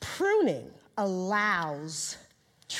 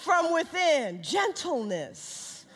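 raised, ringing sermon voice; preaching at full force; 10 semitones higher, 4 dB louder, slower than usual. Acted. Preacher proclaiming fruits of the Spirit, raised and ringing. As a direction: drive each fruit home with fervor.

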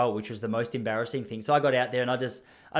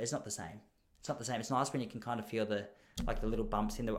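much softer, lightly voiced dreamy wife; 3 semitones lower, 10 dB quieter than usual. Wife explaining why lying on the board is nice, soft and dreamy. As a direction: murmur it like she's drifting on the water.

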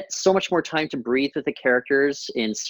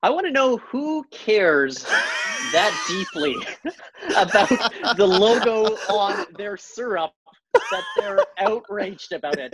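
laughing, slow disbelief; 6 semitones higher than usual. Real, not acted. Podcaster laughing in disbelief at outrage over a syrup logo. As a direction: laugh through the words, baffled anyone cares.

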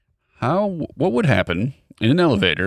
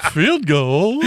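bear voice; 7 semitones higher than usual. Pretend. Continuing the bear voice.

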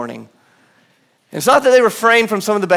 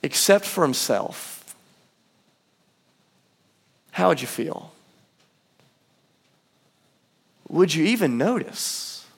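hushed; 2 semitones lower, 8 dB quieter, slower than usual. Real, not acted. Sermon hushed turn.

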